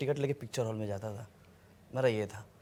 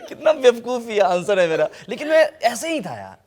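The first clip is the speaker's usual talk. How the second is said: loud, higher, still laughing; laughing self-mockery; 7 semitones higher, 9 dB louder than usual. Real, not acted. Actor owns up to silly answer, laughing loudly. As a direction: talk through the laugh at your own silliness.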